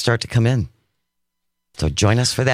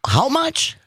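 incredulous; 11 semitones higher than usual. Real, not acted. Astonished at cheese price.

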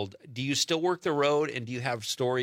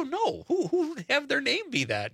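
much higher, incredulous protest; 13 semitones higher than usual. Retold or imitated. To a friend who misheard a name, incredulous. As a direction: exclaim it in high-pitched disbelief.

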